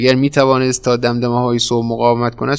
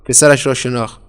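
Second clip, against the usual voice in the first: usual speaking voice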